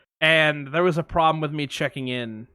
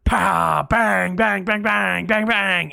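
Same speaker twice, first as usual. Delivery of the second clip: pow bang bang, punchy and higher; imitated bangs; 4 semitones higher, 4 dB louder, much slower than usual. Recreating fireworks for a friend, punchy mock bangs. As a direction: pop out firework bangs one by one. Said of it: "it was like".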